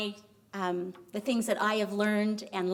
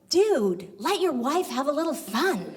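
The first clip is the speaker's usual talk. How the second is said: higher, louder acted reproach; exasperated passer-by; 5 semitones higher, 4 dB louder than usual. Passing guy chides husband, exasperated. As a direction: call out a mock scolding to a stranger.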